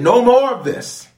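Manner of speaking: high, loud firm command; Jesus commanding. Acted. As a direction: stop them with authority.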